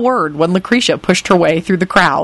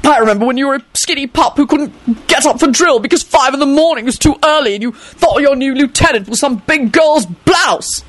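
high, sergeant-like raised voice; furious; 7 semitones higher than usual. A narrator's reading. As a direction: bark the old memory. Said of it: "he shouted furiously".

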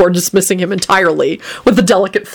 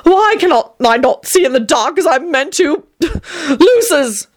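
flustered vampire; 9 semitones higher than usual. A narrator's reading. Tony cursing his clumsiness.

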